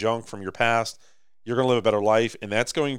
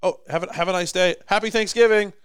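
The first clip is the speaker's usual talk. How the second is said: higher, overly polite voice, building louder to the last words; mock polite stranger; 9 semitones higher, 3 dB louder than usual. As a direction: play a courteous shopper stepping aside, a bit hammed-up. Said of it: "it'd be better if you stopped and went".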